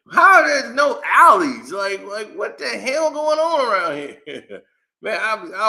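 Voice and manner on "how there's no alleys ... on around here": loud, high-pitched incredulity; baffled outburst, voice raised; confused. Retold or imitated. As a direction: demand an explanation in comic disbelief. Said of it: "I was so confused with New York".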